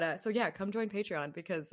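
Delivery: ordinary talk, nothing marked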